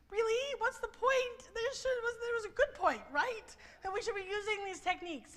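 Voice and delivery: high, sarcastic disbelief; mock incredulous. Panel members scoffing back at the math professor, high mock disbelief. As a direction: play it as an indignant, hammed-up what's the point.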